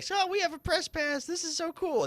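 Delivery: high, giddy voice, way up in pitch; squeaky high fake glee; mock excitement. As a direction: play the thrilled kid with a new press pass. Mock excited over press pass.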